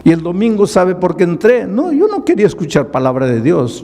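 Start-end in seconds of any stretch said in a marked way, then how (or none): none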